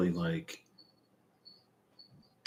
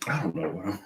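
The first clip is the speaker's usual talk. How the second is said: laughing; 9 semitones higher, 6 dB quieter, faster than usual. Real, not acted. Breaks into laughter mid-sentence.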